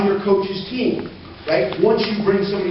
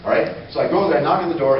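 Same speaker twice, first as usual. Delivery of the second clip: normal talking voice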